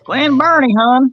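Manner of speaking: put-on playful Baltimore "hon" accent. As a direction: Bawlmer accent, high and loud. Baltimore local mock accent.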